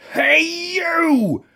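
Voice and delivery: challenging shout. A narrator's reading. Ladybird picks a fight.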